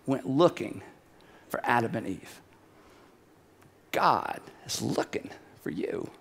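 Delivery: soft, low and solemn; hushed. Real, not acted. Preacher tells listeners God is looking for them, hushed. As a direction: drop low and quiet, letting pauses hang.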